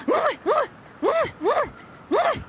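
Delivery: string of high, quick smooches; blown kisses. Pretend. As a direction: blow five kisses in a row. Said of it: "I will give you ten kisses".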